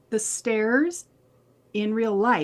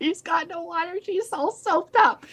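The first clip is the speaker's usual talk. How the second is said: quoting him through laughter; 7 semitones higher than usual. Retold or imitated. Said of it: "And he goes".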